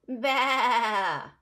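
bleating baa like a little sheep. Shown as a demonstration. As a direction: bleat it out for the children. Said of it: "the sheep says".

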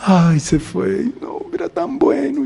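slow, high wailing lament; a grieving relative, slow and high, lamenting the dead; lamenting mourner. Acted. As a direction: mourn the one who is gone, wailing.

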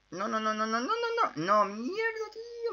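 rapid, rising high-pitched no-no-no; panicked. Real, not acted. Gamer realizing a mistaken move, panicked. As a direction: blurt it out in climbing panic.

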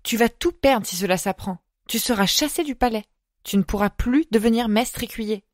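usual speaking voice

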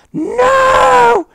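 very high, very loud scream of denial; real shriek of no. Real, not acted. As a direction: scream it as you get killed. Being killed by the chicken.

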